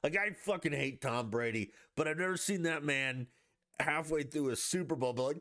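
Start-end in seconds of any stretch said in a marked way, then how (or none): none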